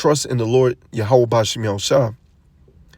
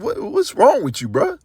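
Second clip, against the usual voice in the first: 1 incredulous reaction; 11 semitones higher than usual. Retold or imitated.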